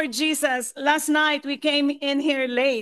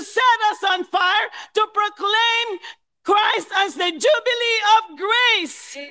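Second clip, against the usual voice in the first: preaching at full force; 5 semitones higher than usual. Acted. Preacher rousing the saints to be set on fire, shouting.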